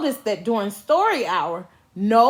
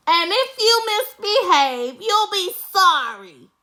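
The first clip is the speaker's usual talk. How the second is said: witchy threat; 7 semitones higher than usual. A narrator's reading. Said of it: "said Miss Swamp".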